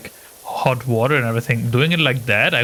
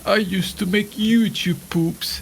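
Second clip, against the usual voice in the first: put-on high, slow old voice; elderly friend impression; 8 semitones higher, slower than usual. Friend imitating Sushi as old, talking to a nurse, high elderly voice. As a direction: reminisce in a high aged voice. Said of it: "imagine, like, Sushi being old".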